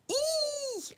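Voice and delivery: very high squeal. Real, not acted. Gamer frightened by a snake, very high squeal.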